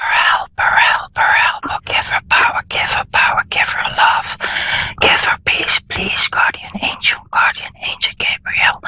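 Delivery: hushed whisper calling on a guardian angel; whispered chant to a guardian angel; whispered prayer. Acted. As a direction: breathe the invocation on a whisper, no voice. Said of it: "I'm going to be quiet".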